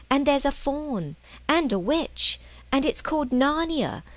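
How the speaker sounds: put-on a small girl bursting to tell her adventure; earnest young girl. Lucy insisting to her siblings she was away for hours, earnest.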